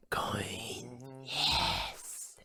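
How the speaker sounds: soft, drawn-out whisper; secretive stretched murmur; whispered coin talk. Acted. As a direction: whisper each word slowly.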